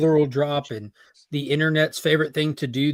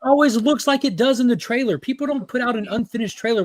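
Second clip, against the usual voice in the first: higher, heated rant; exasperated; 8 semitones higher than usual. Real, not acted. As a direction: push back, fed up. Heated argument about trailers.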